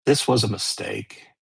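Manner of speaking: a hint of a hard, cold refusal. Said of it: "in that really hard voice".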